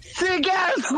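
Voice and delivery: high, hyped-up shout. Real, not acted. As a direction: yell it out at the crew. Caller yelling a catchphrase.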